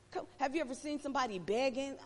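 ordinary talk

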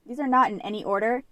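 ordinary talk, nothing marked

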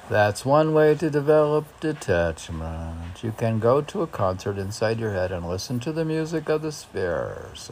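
bright recital voice, lifting each line; poem reading. Acted. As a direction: declaim it like a poetry reading. Reads a meditation poem aloud.

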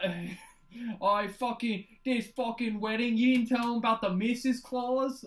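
badly faked British accent, veering toward Scottish; botched British accent. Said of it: "I don't know what accent that was".

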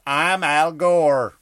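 a hint of a slow, loud Al Gore; Al Gore impression. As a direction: drag it out like a pompous Al Gore. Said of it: "was that an impression or did I just say his name".

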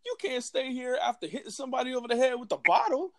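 high, fast mock bouncer scolding; bouncer kicking him out. Bouncer telling the drunk guy to leave, high mock scolding. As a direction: play the exasperated bouncer up high. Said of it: "the guy was like".

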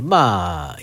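ordinary talk, nothing marked

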